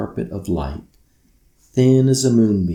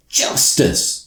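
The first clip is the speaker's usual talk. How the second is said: yelling; 4 semitones higher, 4 dB louder than usual. Acted. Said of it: "she yelled".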